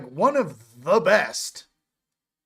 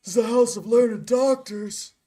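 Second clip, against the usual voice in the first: higher-pitched mock-indignant film line; Step Brothers movie quote; 4 semitones higher than usual. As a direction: put on a comic film-character voice. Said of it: "Step Brothers".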